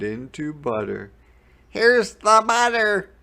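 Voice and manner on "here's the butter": put-on high, loud boy's call; excited Jack shout. A narrator's reading. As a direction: shout it like a proud kid. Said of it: "shouted Jack".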